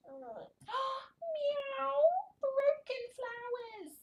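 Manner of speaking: high slow mock-wailing cat; kitten lament. Reading to toddler, high kitten wail. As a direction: whine like a sorry kitten.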